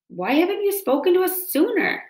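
high-pitched owl screech, moderate volume. A narrator's reading. Owl up in the tree questions the robot, screeching. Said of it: "screeched the owl from higher up".

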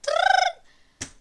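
high, loud mock gun rattle; spray the noise like an enemy; imitating gunfire. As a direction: kid mimicking enemies gunning down a teammate. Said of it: "they're like".

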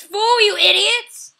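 loud, high-pitched outburst; exasperated shout. Real, not acted. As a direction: yell it at clueless teammates. Exasperated shout at other players.

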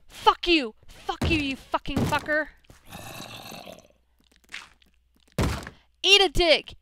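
furious yelling. Real, not acted. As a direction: roar insults at the attacker. Fighting off zombie in rage.

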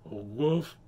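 lightly voiced dog woof; a hint of a dog's bark. Shown as a demonstration. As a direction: give a modest woof as a dog impression. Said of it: "that kind of a dog voice".

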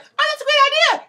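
mock gushing girl. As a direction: ham up a starstruck actress. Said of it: "she was like".

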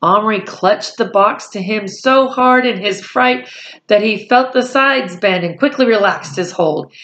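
ordinary delivery, nothing marked

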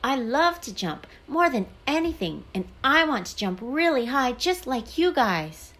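high, gushing outburst; excited blurting. A narrator's reading. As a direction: blurt it out as if you can't hold it in. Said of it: "couldn't help himself and blurted".

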